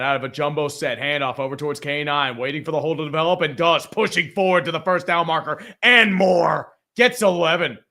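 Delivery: sportscaster calling the play. Acted. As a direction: call it like a TV announcer. Live game play-by-play.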